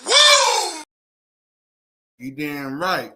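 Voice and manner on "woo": high, loud whooping cheer; victory cheer. Real, not acted. Host cheering for the stream chat, loud whoop. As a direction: shout it out in celebration.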